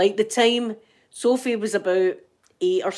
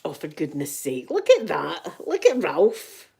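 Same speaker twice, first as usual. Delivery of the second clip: ordinary talk, nothing marked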